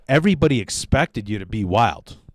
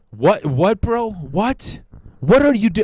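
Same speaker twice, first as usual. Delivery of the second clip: mock disbelief; 8 semitones higher, slower than usual. Mock outraged reaction.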